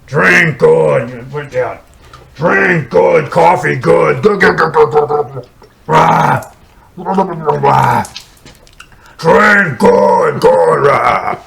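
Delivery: loud, grunting monster shouts; Frankenstein's monster. Retold or imitated. Monster praising drink to his friend, loud grunts. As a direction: bellow broken words like the monster. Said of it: "he goes".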